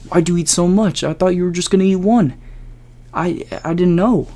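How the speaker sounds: a caught-out Jared scrambling; flustered friend. Retold or imitated. Jared realizing six were eaten, high panicky excuse.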